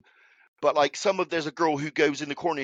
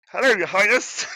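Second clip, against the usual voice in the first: a higher, louder mock voice of the man he met; imitating the man; 5 semitones higher, 5 dB louder than usual. Asked how the man sounded, a higher, louder imitation. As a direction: ham up the stranger's greeting, pitched up and loud. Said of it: "what did he sound like?".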